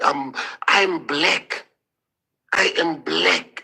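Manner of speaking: claiming his identity with slow, firm weight; emphatic. Real, not acted.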